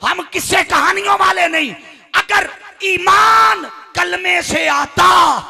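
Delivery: high, loud sermon shout; full-force preaching. Acted. Preacher refuting a claim before the congregation, thundering. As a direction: argue it at the top of the voice, stretching key words.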